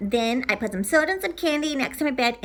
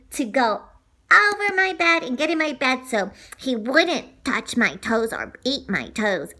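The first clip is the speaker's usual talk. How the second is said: plain reading voice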